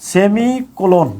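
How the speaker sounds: সেমিকোলন said slowly, each syllable clear; সেমিকোলন pronounced slowly and carefully; slow clear naming. Shown as a demonstration. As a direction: spell out সেমিকোলন slowly for the class. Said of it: "এটাকে আমরা বলছি সেমি".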